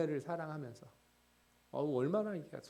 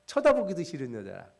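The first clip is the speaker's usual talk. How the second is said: louder preaching voice, pressing rhetorical questions; impassioned preaching; 6 semitones higher, 11 dB louder than usual. Acted. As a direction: preach it out, challenging the congregation. Preaching at full force, rhetorical questions.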